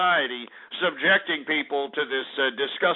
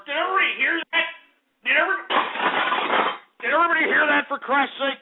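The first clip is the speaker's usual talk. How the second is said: outraged appeal; 9 semitones higher, much faster than usual. Real, not acted. Host appeals to listeners, outraged.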